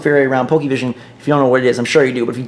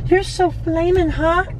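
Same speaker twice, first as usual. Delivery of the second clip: hammed-up flirting, pitched way up; mock flirty voice; 12 semitones higher, 3 dB quieter than usual. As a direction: croon it like a cheesy pickup line. Flirting with a Ponyta.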